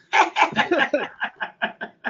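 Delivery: long high laugh. Real, not acted. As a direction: crack up laughing. Laughing at Terry W. again.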